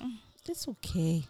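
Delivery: soft, soothed acceptance; gentle, hushed reassurance; calm self-reassurance. Retold or imitated. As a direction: quietly talk yourself down. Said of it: "just being more calm".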